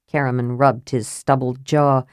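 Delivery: ordinary delivery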